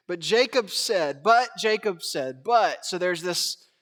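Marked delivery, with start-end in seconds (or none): 0.1-2.4 slow, weighty reading. Shown as a demonstration. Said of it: "Just read this slowly with me".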